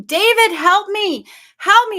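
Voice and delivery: high, loud panicked cries; screaming for help. Retold or imitated. Woman calls her partner as the snake comes, panicked screaming. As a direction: reenact a desperate scream for rescue. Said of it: "I was, like, screaming".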